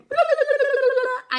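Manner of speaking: high, loud mock babble on one note; doctor droning on. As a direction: imitate incomprehensible nonstop talk. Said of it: "and just spoke at me for".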